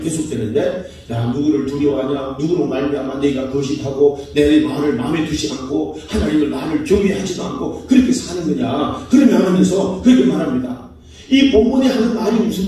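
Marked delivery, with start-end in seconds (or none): 1.1-9.1 low-pitched, lightly voiced divine rebuke; God's challenge. Preacher voices God questioning whom they fear, lightly acted low rebuke. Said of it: "하나님이 이제 계속해서 이 변론과 도전".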